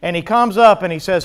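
ordinary delivery

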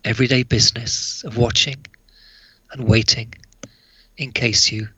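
measured recital with long pauses between lines; poem reading. Acted. Author reading his angel poem to listeners, hushed-ending poem recital.

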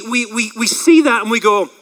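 ordinary delivery

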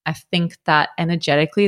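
ordinary talk, nothing marked

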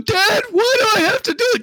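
put-on very high, loud, crying kid's complaint through laughter; whiny kid voice. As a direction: wail it like a put-upon son. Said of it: "I start doing the voice going".

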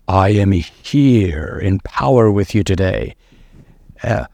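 low, slow, booming self-important voice; mock grand spirit. Preacher mocking a self-focused spirit, low and slow. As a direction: play a pompous spirit announcing itself. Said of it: "He's not saying like".

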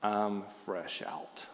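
low, slow, quiet withering line; Clint Eastwood. Retold or imitated. Eastwood answering the villain, low slow quiet contempt. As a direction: deliver it cold and slow, dead quiet. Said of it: "withering look of indignation and says".

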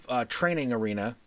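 ordinary delivery

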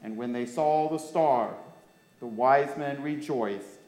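put-on clear, lively storytelling for children; story reading. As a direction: read the Christmas story to the kids. Reads Bible story to children.

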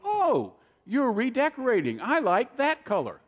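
high, gushing "oh, you're redecorating"; gushing visitor. Retold or imitated. House guest admiring the swatches, high gushing voice. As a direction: coo over the swatches like an impressed guest. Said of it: "they would go".

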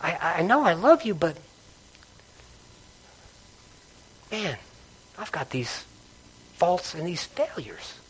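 barely voiced, owning your failures; confessing faults to the Lord, very soft; hushed confession.

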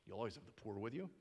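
quick, soft, brushing "You'll always have the poor with you" aside; dismissive. Shown as a demonstration. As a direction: toss it off fast and lightly. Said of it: "It's almost dismissive".

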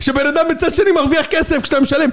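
high, shouted exposé outrage; hammed-up reporter, starting slow and drawn out; mock economic TV report. Parodying TV reports on who profits, mock-outraged reporter. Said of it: "תמיד יש כתבה כלכלית".

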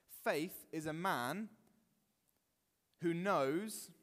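ordinary delivery

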